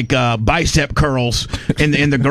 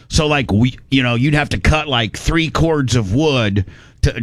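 normal talking voice

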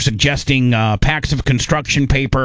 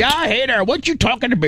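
much higher, hammed-up Canadian; Canadian accent; 10 semitones higher than usual. Host hamming up Canadians. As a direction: pitch it way up like a cartoon Canuck. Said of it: "my Canadian".

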